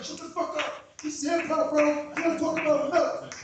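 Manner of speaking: normal talking voice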